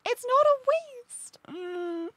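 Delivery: high, slow, hurt protest; defensive character voice. Acted. Character defensive reply, game scene.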